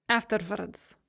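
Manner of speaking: put-on harsh Ukrainian accent. A narrator's reading. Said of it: "her accent harsh".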